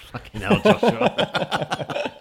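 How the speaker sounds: laughing in disbelief, high; exasperated laughing outburst. Real, not acted.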